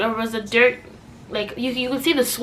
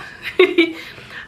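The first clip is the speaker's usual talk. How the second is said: laugh; 7 semitones higher than usual. Real, not acted. Laughing at the lie.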